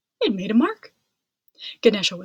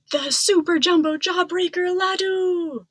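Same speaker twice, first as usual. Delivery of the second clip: higher, louder announcement of a wondrous find; excited discovery; 6 semitones higher, 4 dB louder than usual. A narrator's reading. Narrator revealing the giant laddu to listeners, excited awe.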